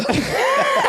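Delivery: very high whooping laugh; real high burst of laughter; shouted laugh. Real, not acted. Laugh shout mid story.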